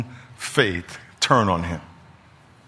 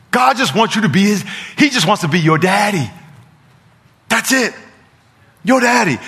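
high, loud, driving sermon delivery; preaching at full force; 8 semitones higher, 4 dB louder than usual. Real, not acted. Preacher urging congregation to do kingdom works, full-force preaching. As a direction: preach it hard, pitch up.

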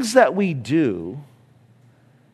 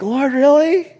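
high, mock-whiny complaint; lightly hammed-up whine; whiny disbelief; 9 semitones higher, 4 dB louder than usual. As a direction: play the complaining believer. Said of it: "When we're like".

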